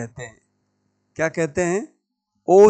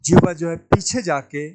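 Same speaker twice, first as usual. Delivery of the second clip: ordinary talk, nothing marked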